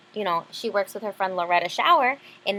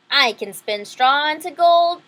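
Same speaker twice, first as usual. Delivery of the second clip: loud, higher, slow smug boast; smirking little man; 5 semitones higher, 9 dB louder, slower than usual. Acted. Little man smugly offers weeping Isabel help, loud and slow. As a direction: smirk the offer like a sly imp. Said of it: "the little man smirked".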